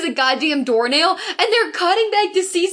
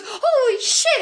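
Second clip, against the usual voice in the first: retold scream; 8 semitones higher, much slower than usual. Imitating a friend's reaction to the movie, high mock scream. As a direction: imitate a shocked scream, pitched up. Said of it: "Lou screamed".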